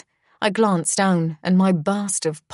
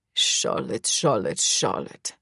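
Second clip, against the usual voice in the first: Heinrich; 8 semitones lower, 4 dB quieter, slower than usual. A narrator's reading. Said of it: "Despite Heinrich's clearly annoyed tone".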